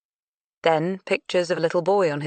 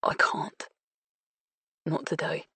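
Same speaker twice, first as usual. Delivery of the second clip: breathy whisper held back from sobs; 6 dB quieter than usual. Acted. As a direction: whisper it, barely holding on. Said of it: "held in the sobs".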